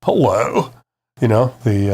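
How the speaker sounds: mock Australian hello; playful Australian impersonation; Australian accent. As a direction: put on an Aussie accent for a greeting. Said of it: "with an Australian accent".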